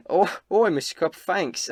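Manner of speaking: high, pleased innkeeper voice; flustered Elsa. Acted. Elsa thanking her lover for a morning compliment, flustered high voice.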